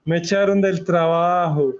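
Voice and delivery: hammed-up sobbing over his misfortunes; mock crying drunk. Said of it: "Y ahí sí se puso a llorar".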